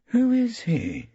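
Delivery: high, slow, worried whisper-like ask; anxious Hilda. A narrator's reading. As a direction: ask nervously. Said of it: "she asked anxiously".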